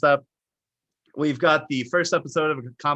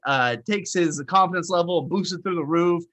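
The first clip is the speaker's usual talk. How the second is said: normal talking voice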